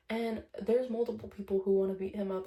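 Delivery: normal talking voice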